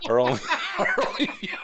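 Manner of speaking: fast, high giggly words; laughing at his own joke. Real, not acted. Host joking about losing their only viewer, laughing.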